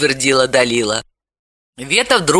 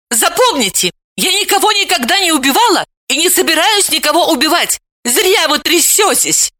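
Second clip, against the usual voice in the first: put-on higher, firm girl's voice, slowing at the end; curt retort; 13 semitones higher than usual. A narrator's reading. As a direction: cut her off decisively. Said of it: "отрезала Вета".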